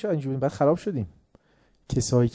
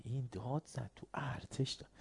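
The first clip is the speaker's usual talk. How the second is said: whisper; 2 semitones lower, 18 dB quieter than usual. Retold or imitated. Soldiers whispering in alarm.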